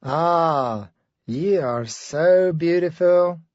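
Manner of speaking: put-on slow, crooning Turkish accent; mock the suitor's slow Turkish accent. Girlfriend retelling the gentleman's compliment, slow mock Turkish accent. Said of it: "with this Turkish accent".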